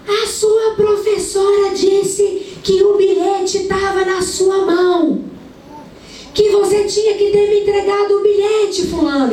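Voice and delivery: mother yelling at her son over a school note; retold yelling mother. A mother on the balcony yelling at her son, high shrill scolding. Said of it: "gritando o nome dele".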